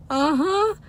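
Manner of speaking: very high, loud, drawn-out mock agreement; squeaky playing along. Embarrassed fan playing along with their kind excuse, squeaky.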